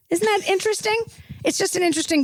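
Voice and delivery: high, faux-innocent "isn't it funny"; sarcastic wonder. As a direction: play dumb with a sting.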